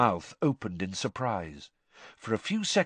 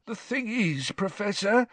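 put-on high, whining old caretaker; plaintive, wheedling appeal to the professor; 10 semitones higher than usual. A narrator's reading. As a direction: complain like a put-upon caretaker. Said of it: "said Filch plaintively".

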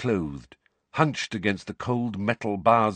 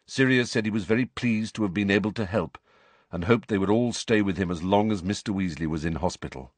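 usual speaking voice

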